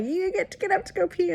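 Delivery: high, singsong mock-cheerful announcement; staff announcing. Nurses tell the bedridden patient she may pee: high mock-cheery. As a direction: chirp it like big news. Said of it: "they were like".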